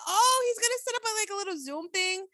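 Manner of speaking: high-pitched, eager realization; excited anticipation. Retold or imitated. Podcaster recalling her guess about a Zoom date, excited. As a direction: act out a delighted, hopeful guess.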